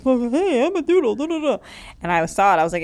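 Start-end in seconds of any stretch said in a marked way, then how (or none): 0.0-1.6 high, silly voice of a dumb happy dog; doodle dog voice. Acted. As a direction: play the clueless doodle sniffing around.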